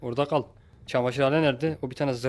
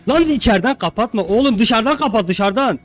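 loud, high, insistent order; exasperated shouting; 8 semitones higher, 8 dB louder than usual. Real, not acted. Player correcting teammate on the door, exasperated shout.

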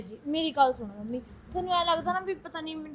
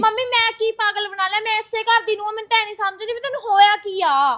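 raised, higher indignant retort; upset protest; 6 semitones higher, 6 dB louder than usual. Acted. Daughter-in-law protesting loudly to her mother-in-law, indignant. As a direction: push back hard against the accusation.

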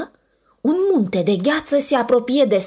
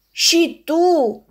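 indignant; 8 semitones higher, 3 dB louder than usual. Acted. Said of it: "se supără ursul".

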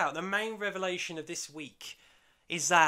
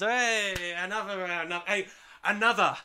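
loud, higher, rowdy call for another round; slurring tipsy shout for more drinks; drunken calling out; 4 semitones higher, 5 dB louder than usual. Retold or imitated. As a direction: bellow it like a drunk at the bar.

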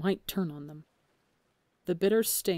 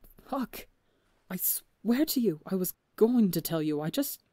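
breathless, pitched-up panic; panicked pleading; 3 semitones higher, 3 dB louder than usual. A narrator's reading. Phil swearing to the crew, rising panic. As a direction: beg them to believe you. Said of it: "flicker of panic he hears".

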